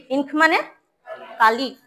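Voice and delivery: normal talking voice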